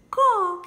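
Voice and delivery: "Co" called out high and loud; syllable sounded out. Shown as a demonstration. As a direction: sing out the syllable "Co" for the children. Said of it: "Como é que lê essas duas letrinhas?". (sílaba "Co" bem alta e forte)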